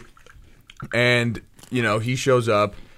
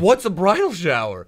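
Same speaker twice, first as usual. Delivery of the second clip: retold baffled question; 6 semitones higher than usual. His clueless reply acted out.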